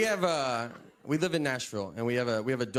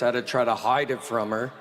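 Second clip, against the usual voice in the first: ordinary talk, nothing marked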